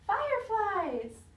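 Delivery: long, loud call down the street; drawn-out excited call to friends outside. Acted. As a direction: stretch it out to call your friends over. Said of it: "I called my friends in the street".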